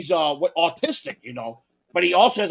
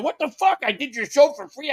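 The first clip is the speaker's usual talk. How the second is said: mock outrage; 7 semitones higher than usual. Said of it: "and John said".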